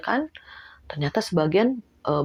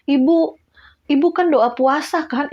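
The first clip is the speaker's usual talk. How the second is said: high, loud coaxing nudge; coaxing reminder; 7 semitones higher, 4 dB louder than usual. Retold or imitated. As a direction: coax her gently to calm. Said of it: "ke teman saya dielus-elus". (bujukan mengingatkan)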